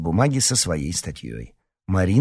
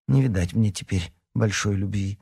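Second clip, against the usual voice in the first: usual speaking voice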